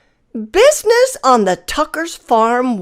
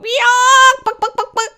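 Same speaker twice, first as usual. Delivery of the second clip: hen squawking; 13 semitones higher than usual. A narrator's reading. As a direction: squawk to catch the cars. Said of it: "clucked and squawked".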